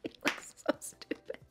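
stifled whisper through giggles; voiceless, hushed aside. Real, not acted. To the camera, whispering while holding in laughter.

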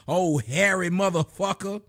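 ordinary talk, nothing marked

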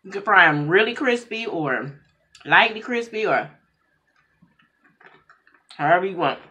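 eating between words; talking while chewing. Real, not acted. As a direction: mouth full of noodles. Seen 0: Puts noodles in mouth at 3.4s, chews while talking after.

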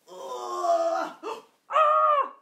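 loud, high shriek of surprise; big mock fright at the puppet; startled gasp and yelp. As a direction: jump as the bear appears. Puppet bear pops out.

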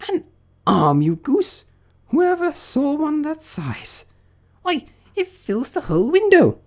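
very high, scolding rabbit voice; indignant rabbit. Acted. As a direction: scold the servant in high disbelief.

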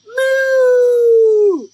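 long, loud, high moo; excited cow. Acted. Reader voices cow eager about the car, excited loud moo. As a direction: belt out a thrilled cow moo. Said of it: "Oh, Cal looks excited".

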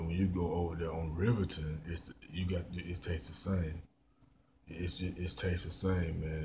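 ordinary talk

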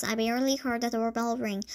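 plain reading voice

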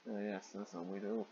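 soft, dry sarcasm; sarcastic aside. Real, not acted. Reader quietly mocking the bathroom scene, sarcastic. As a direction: say it deadpan, under the breath.

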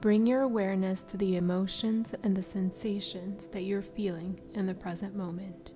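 ordinary delivery

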